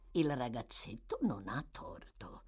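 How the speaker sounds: low, soft murmur. A narrator's reading. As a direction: murmur it under your voice.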